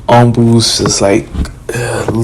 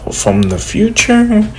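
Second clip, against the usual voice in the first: high, slow disbelieving echo; surprised; 9 semitones higher, slower than usual. Retold or imitated. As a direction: repeat "from the future" in shock.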